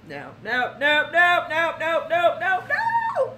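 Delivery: no-no-no building to a high squeal; emphatic no. Real, not acted. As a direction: climb to a squeal. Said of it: "emphatic refusal, rising".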